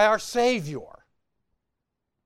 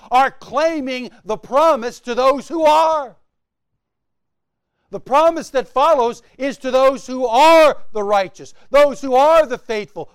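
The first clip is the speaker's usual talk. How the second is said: loud, higher build on each "are"; preaching climax; 4 semitones higher, 6 dB louder than usual. Real, not acted. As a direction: thunder it from the pulpit.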